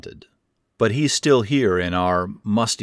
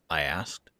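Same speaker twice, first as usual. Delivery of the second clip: plain reading voice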